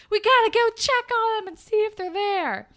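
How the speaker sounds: loud, very high imitated panic; mock urgency. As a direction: imitate friends urgently rushing off. Retold urgent friends.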